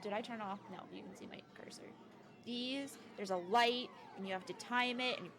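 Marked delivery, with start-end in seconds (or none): 0.7-1.8 quick whispered aside; whispering. Real, not acted. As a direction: whisper it fast under breath. Quick aside about cursor.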